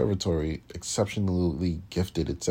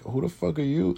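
much higher, incredulous rising question; mock bafflement; 10 semitones higher than usual. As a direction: mimic the stunned stare. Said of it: "because it was just like".

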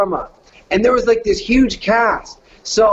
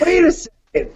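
sudden realization; 10 semitones higher than usual. Retold or imitated. Acted sudden realization in retelling.

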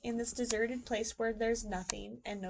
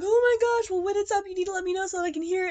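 gushing aunt; 9 semitones higher, 9 dB louder, faster than usual. Retold or imitated. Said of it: "they're like".

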